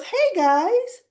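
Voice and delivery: high, bright-voiced hello; mock sweet hey guys; chirpy greeting. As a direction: sing out a cheery hello. [name] greeting the brothers.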